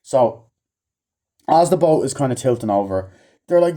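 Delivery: ordinary talk, nothing marked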